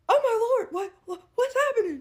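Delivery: high, flustered stammer; retold panic. Shopper as woman runs at her, retold flustered panic. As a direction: stumble over it in mock panic.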